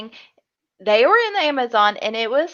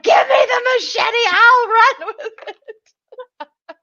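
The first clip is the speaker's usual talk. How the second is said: high, shouted bravado, slowing down into a laugh; hammed-up cocky yell, high and laughing; cocky mock shout; 12 semitones higher, 4 dB louder, slower than usual. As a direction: grab it back with a laughing shout. James's cocky reply to Will.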